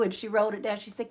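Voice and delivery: normal talking voice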